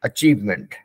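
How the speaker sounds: "Achievement" repeated on its own, slow and clear; teacher repeats the word; correct pronunciation. Shown as a demonstration. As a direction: model "Achievement" with a falling end. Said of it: "it should be pronounced as achievement".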